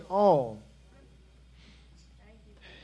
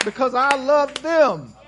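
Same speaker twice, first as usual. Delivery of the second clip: a hint of Christ resolving to endure, preached louder; lightly voiced Christ, pushing through his pain out of love; Christ on the cross, preached; 3 semitones higher, 11 dB louder than usual. Pastor voicing Christ's resolve to his congregation, lightly voiced and preached louder.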